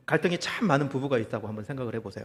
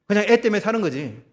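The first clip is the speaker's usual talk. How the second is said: louder, punching 애 in "그냥 애 때문에 사는 거지"; emphatic declaration; 5 semitones higher, 8 dB louder than usual. Acted. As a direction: land hard on 애 as if fed up. Preacher sighs over a marriage.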